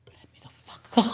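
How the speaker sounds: voiceless, urgent mock plea; desperate whisper. Client wanting out of the ritual, desperate whisper. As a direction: whisper it as if itching to escape. Frightened client wants to leave.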